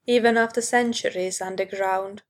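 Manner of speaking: ordinary delivery, nothing marked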